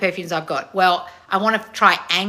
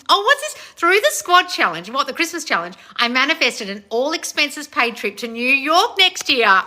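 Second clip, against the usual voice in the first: high, loud, thrilled read-out; giddy, raised-pitch delight; amazed excitement; 8 semitones higher, 6 dB louder than usual. Real, not acted. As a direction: announce the big news as if astonished. Rereads trip news, amazed.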